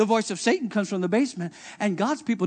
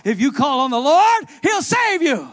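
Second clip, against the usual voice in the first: rising, louder preacher's call; preaching; 6 semitones higher, 9 dB louder than usual. Acted. Preacher calling the church to the Lord, full force. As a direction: preach it out to the congregation.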